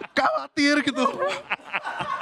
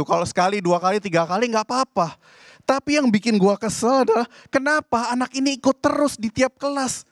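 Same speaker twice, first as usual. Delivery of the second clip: usual speaking voice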